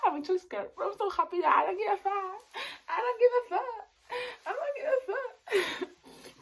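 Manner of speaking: very high-pitched, giddy outburst; scared but overjoyed. Real, not acted. Vlogger declaring she doesn't care, giddy and overjoyed.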